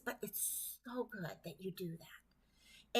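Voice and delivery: whispered and slowed, x0.7; hushed, tender reassurance in a whisper; soft whispered encouragement. Real, not acted. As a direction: breathe it softly, like a quiet reassurance. Gentle encouragement to callers.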